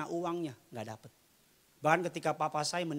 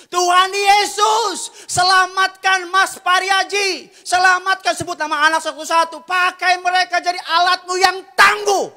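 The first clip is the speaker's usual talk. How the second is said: high, loud pleading to God; re-enacted revival prayer, shouted and drawn out; 20 dB louder, slower than usual. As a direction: cry out the intercession at full force. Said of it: "langsung teriak".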